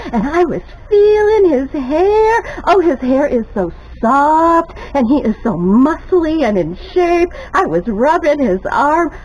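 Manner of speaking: starstruck gushing. Retold or imitated. Fan gushing to viewers about hugging Bobby Dean, high and swooning. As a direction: gush like a starstruck fan, voice cracking.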